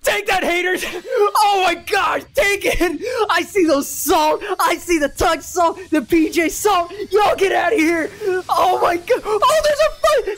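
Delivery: high-pitched victory shouting; real overexcited celebration; ecstatic, can't calm down. Real, not acted. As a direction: shout in breathless triumph at a lucky win. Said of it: "I can't calm down".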